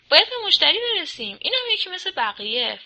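high, loud, indignant snap; bristling retort. A narrator's reading.

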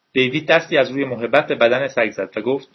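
ordinary delivery

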